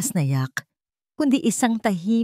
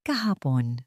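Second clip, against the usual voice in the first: ordinary delivery, nothing marked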